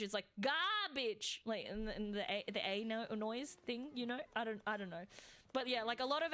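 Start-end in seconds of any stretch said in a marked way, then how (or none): 0.4-1.4 "Garbage" with a long open A and no R: gaah-bij; Australian garbage. Shown as a demonstration. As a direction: say "Garbage" the Aussie way, open A, dropped R. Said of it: "when we say garbage".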